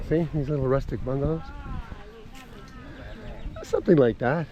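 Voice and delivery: ordinary talk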